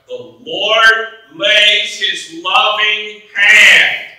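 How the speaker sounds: loud, high, rousing sermon; rising preacher's call; full-force preaching. Acted. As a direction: preach it out to the congregation.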